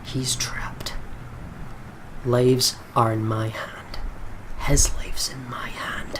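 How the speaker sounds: slow, hushed menace; a gunman whispering over his trapped target; whispered inner monologue. Acted. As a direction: let each word hang in a whisper.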